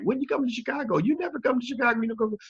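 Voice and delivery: very high-pitched mocking whine of complaint; high falsetto nagging, imitating others; complaining fans. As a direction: mimic pestering fans in a squeaky falsetto. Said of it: "people have been like".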